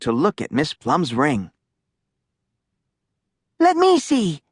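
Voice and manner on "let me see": put-on eager girl. A narrator's reading. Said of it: "said Sophie".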